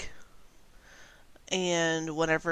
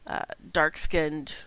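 ordinary delivery

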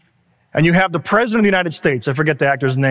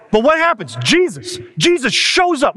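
preaching at full force; 7 semitones higher than usual. Acted. Pastor preaches to the congregation that Jesus shows up, impassioned. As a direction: drive the point home to the congregation.

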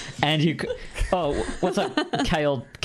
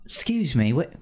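gruff Cockney bird, teasing; Cockney accent; 3 semitones higher than usual. Acted. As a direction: crow speaking in a Cockney accent. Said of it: "my Cockney accent".

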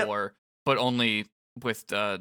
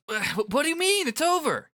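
high, startled disbelief; stunned, voice jumps up; shocked. Acted. Rindo shocked, much higher.

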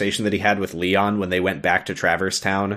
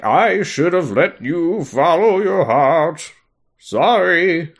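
drawn-out, higher, hammed-up king; Triton; 6 semitones higher, slower than usual. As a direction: stretch every word in a mock-dramatic apology. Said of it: "and Triton's like".

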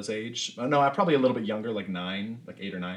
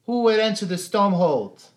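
put-on high, loud child's challenge; a small girl playing sentry, puffed up; child shouting halt at strangers; little girl guard; 12 semitones higher, 10 dB louder than usual. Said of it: "and she goes".

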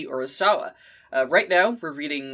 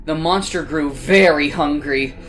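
plain reading voice

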